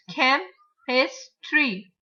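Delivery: CHEM, IS, TREE as three detached beats; broken into syllables. Shown as a demonstration. Teacher repeating the three syllables, each said apart. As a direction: say "Chem is tree" one beat at a time. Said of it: "three syllables".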